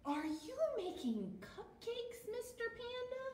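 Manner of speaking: squeaky little bunny asking sweetly; high bunny voice. Acted. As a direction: voice the small bunnies up high. Bunnies ask Panda in story.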